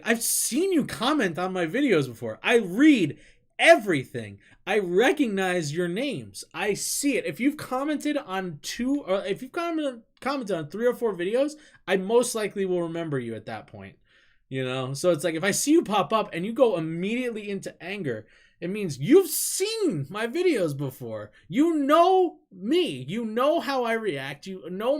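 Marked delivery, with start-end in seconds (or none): 0.0-14.0 higher, louder earnest appeal; retold pleading. Streamer addressing commenters, higher and louder pleading. 21.5-23.1 high, loud and slow; emphatic rant. Real, not acted. Streamer scolding angry commenters, slow and emphatic.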